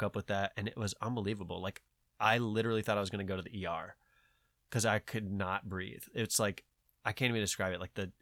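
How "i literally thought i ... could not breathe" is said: ordinary talk, nothing marked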